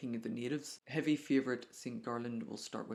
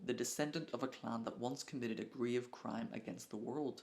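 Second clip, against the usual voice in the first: usual speaking voice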